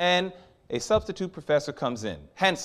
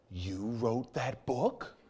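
slow, soft, hushed astonishment; stunned disbelief; 2 semitones lower, 9 dB quieter, much slower than usual. Retold or imitated. Student learns the professor wrote the book, hushed and slow. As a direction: let it fall out in quiet shock.

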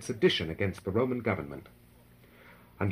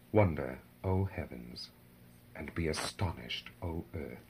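hushed awe; 6 semitones lower, 8 dB quieter than usual. A narrator's reading. The narrator calls heaven and earth to wonder, hushed and low. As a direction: drop low and soft, with solemn pauses.

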